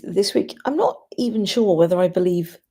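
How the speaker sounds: normal talking voice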